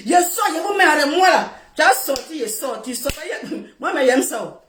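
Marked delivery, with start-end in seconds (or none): none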